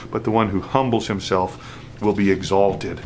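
ordinary talk

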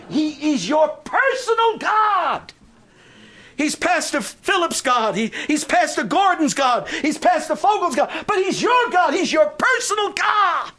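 high, loud, ringing pulpit proclamation; full-force preaching. Acted. Pastor to congregation, proclaiming God's power at full force.

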